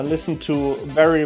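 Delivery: usual speaking voice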